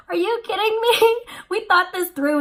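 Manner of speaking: high, incredulous, half laughing; teasing Megan for spilling the drinks, disbelieving; exasperated disbelief. Retold or imitated. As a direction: scold her with a laugh in it. Friend spills the orange juices.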